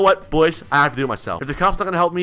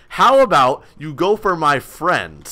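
ordinary talk, nothing marked

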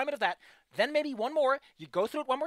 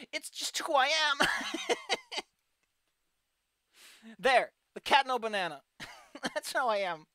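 laughing; 3 semitones higher, slower than usual. Real, not acted. Laughing at banana cat video.

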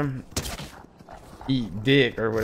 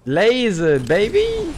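loud, very high victory yell; hype shout; 10 semitones higher, 7 dB louder, much slower than usual. Real, not acted. Cheering his own laser shot in the game, loud and high. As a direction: celebrate the hit at full voice.

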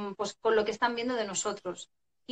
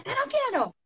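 put-on very high kid's refusal; defiant child. Child refusing a parent's order, high defiant whine. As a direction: imitate a child whining back in protest. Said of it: "el niño te mira y te dice".

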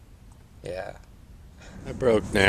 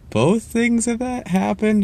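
way up high and slow, can't believe it; squeaky, drawn-out what-are-the-odds; incredulous; 12 semitones higher than usual. Real, not acted. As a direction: stretch it out in pure disbelief. Disbelief at her excuse.

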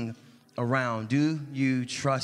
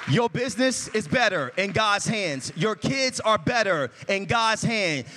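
a bit louder preaching cadence; rising preaching; 6 semitones higher, 5 dB louder than usual. Acted. Preacher reassuring the church, lifted preaching voice. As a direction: lean into it, stress better and God's.

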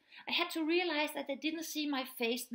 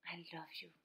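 much softer and lower; soft, tender; 6 semitones lower, 14 dB quieter, much faster than usual. Shown as a demonstration. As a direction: say it low and hushed. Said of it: "if you are yelling or if you're whispering".

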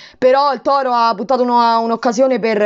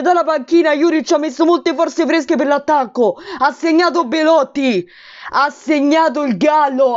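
raised, urgent play-by-play; breathless italian goal commentary; 4 semitones higher than usual. Real, not acted. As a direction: call the goal, still buzzing.

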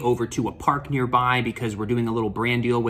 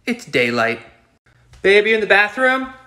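ordinary delivery

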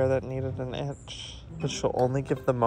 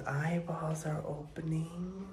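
hushed whisper to the puppies; 5 semitones higher, 6 dB quieter, slower than usual. Real, not acted. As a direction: whisper softly so they stay calm.

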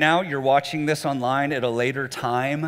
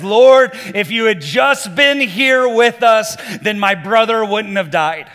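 loud, higher, accusing cry at Jesus; angry accusation; 4 semitones higher, 6 dB louder, slower than usual. Retold or imitated. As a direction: hurl the blame at him, loud and slow. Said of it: "starts yelling at Jesus".

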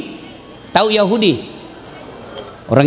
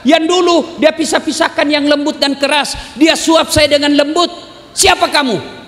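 high, raised, accusing complaint; indignant blind man; 10 semitones higher than usual. Retold or imitated. Blind man scolding Abu Bakar for feeding him roughly, indignant and raised. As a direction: push the stranger away, demanding who he is.

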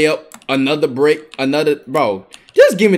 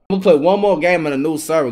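ordinary delivery

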